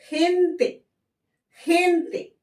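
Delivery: हेंते high, with a breathy h; Spanish h sound. Shown as a demonstration. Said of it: "तो क्या निकल रहा है".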